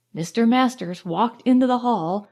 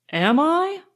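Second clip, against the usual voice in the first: cool; 6 semitones higher than usual. A narrator's reading. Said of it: "he said coolly".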